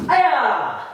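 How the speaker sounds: high, sharp martial arts yell; kiai shout. Shown as a demonstration. Instructor leading the class with a high kiai shout. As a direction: snap out the hi-ya on the strike.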